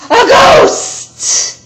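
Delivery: jump-scare yell; sudden scary shout. Acted. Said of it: "Missus Wimpy Dimple cried".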